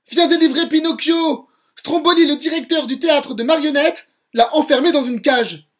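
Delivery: loud, high, breathless call for help; a tiny cricket yelling to Geppetto to come quickly; urgent shout. Acted. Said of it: "Il crie".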